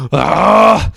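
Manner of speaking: ordinary delivery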